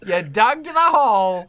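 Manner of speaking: loud, high, drawn-out taunt; teasing insistence. Real, not acted. Host teasing Eric after his accent attempt, loud mock blame. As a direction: hammer it home with mock blame.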